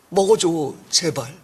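soft begging. Acted. Begging softly, laughing.

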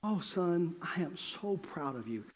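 soft, slowing motherly voice; imitated mother, hushed and measured; tender proud mother. Mother answers her excited son.

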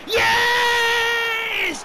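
very high, loud, drawn-out celebration; ecstatic scream. Real, not acted. Gamer celebrating a final-minute goal, ecstatic scream. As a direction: scream it out as the winner goes in.

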